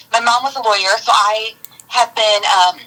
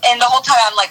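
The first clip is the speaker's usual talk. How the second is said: ordinary talk, nothing marked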